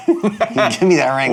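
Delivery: laughing demand. Acted. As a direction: beg for the ring through laughter. Repeated, laughing, slower.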